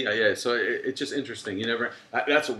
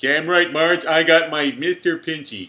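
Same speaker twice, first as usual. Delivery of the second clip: loud, high cartoon dad; slow goofy cartoon bellow; Homer Simpson impression; 6 semitones higher, 7 dB louder, slower than usual. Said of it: "Homer Simpson used to have a lobster".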